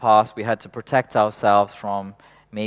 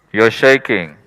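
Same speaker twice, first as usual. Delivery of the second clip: inner voice; 10 dB louder than usual. Retold or imitated. Inner voice accusing the speaker.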